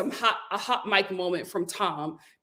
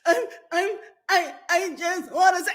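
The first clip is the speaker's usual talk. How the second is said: halting, higher, mock-stammered start; stammering Tom; 8 semitones higher than usual. Tom trying to speak at the reunion, mock stammer. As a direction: stumble over the words like a fake-sad man. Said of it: "He was like".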